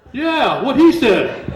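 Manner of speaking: loud, high shout of backing; cheering bystanders. Retold or imitated. Elevator passengers backing the speaker up, loud high cheer. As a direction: pipe up loudly as the crowd's hype man. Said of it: "people in the elevator were, like, going".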